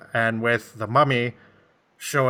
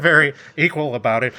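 normal talking voice

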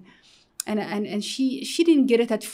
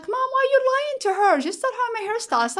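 exasperated; 4 dB louder than usual. Retold or imitated. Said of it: "I'm like".

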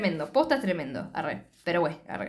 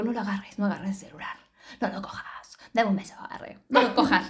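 imitated whisper. Luz begging Ainhoa in a whisper to ignore the call. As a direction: whisper close, begging her to ignore the phone. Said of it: "que le susurre casi en la boca".

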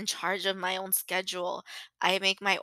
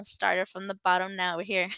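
normal talking voice

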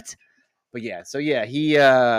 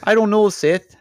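high, loud mock-Canadian voice; hammed-up Canadian accent pitched way up; 13 semitones higher, 7 dB louder than usual. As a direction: squeal it as a comic Canadian. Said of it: "Maybe he's just Canadian".

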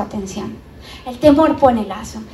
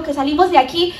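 ordinary talk

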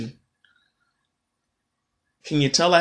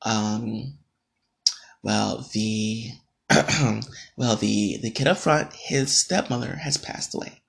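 soft, halting, awkward imitation of the coworker; meek hesitant voice; 4 semitones lower, 7 dB quieter, slower than usual. Coworker awkwardly tells him about the card, imitated softly and haltingly. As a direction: mimic someone nervously breaking bad news, softly.

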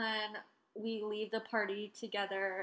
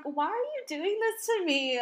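high, pleading whine, imitating herself; mock whiny plea; 10 semitones higher, 5 dB louder than usual. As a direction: wail it like a wronged girl. Retelling her upset reply.

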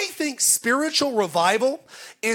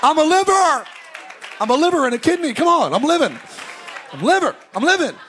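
high, loud, elated shouting; overjoyed to be alive; 5 semitones higher, 5 dB louder than usual. Retold or imitated. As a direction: yell it like you just cheated death. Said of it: "I'm like".

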